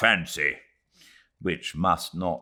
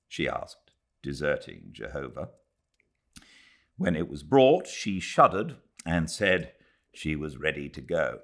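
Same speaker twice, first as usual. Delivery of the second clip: ordinary delivery, nothing marked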